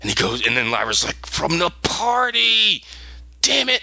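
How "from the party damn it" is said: mock outburst, high and drawn out; slow, high-pitched retold exclamation; exasperated realization. Lyra realizing where they met, exasperated.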